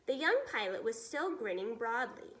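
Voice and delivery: ordinary delivery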